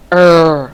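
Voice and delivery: low, held "Er" buzzing in the throat; sustained voiced "Er," demonstrated for learners; voiced R sound. Shown as a demonstration. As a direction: hold "Er" long and low so the neck vibrates. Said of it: "cần cổ của bạn sẽ rung".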